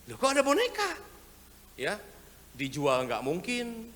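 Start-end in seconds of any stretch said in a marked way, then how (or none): 0.1-0.9 high, loud startled exclamation; mock-startled church treasurer; surprised gasp. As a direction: react like you just found a doll in the offering bag.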